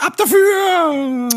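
high, slow, stretched yell; drawn-out shout. Acted. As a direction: belt it out like a radio jingle. (langgezogener Schrei)

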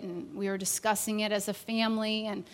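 ordinary talk, nothing marked

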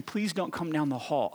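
normal talking voice

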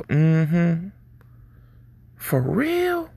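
drawn-out interested hums, pitch leaping up at the end; attentive listener. Acted. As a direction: play the engrossed listener, voice rising high in mock interest. Acting an attentive listener's responses.

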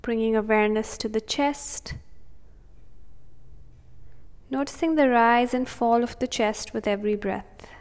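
soothing, unhurried breathing cues; calm meditative tone. Acted. Meditation continues.

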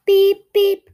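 high loud electronic beep; watch beeping. A narrator's reading.

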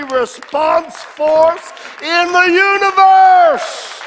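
loud, high, shouting preacher; preaching at full force. Acted. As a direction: build it to a roar.

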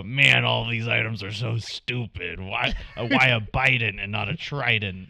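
loud pirate-like voice; Clay voice. Retold or imitated. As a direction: bellow it like a pirate. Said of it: "my Clay voice sounds like a pirate".

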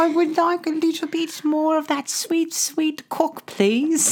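hammed-up high dainty request; high mock plea. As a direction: beg sweetly in a tiny high voice. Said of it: "Excuse me".

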